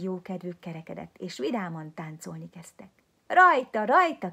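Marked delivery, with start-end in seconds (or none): 3.3-4.3 much higher, much louder rallying cry; joyful shout. A narrator's reading. Misuli starting the dance at the ball, shouting joyfully. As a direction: call everyone to dance. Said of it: "kiabálta Misuli".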